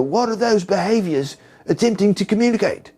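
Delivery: high-pitched, hammed-up British accent; bad British accent. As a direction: put on a terrible British TV-host accent. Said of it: "my worst, you know, British accent ever".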